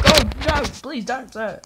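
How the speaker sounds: loud, hammed-up plea; mock pleading. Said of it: "I'm joking".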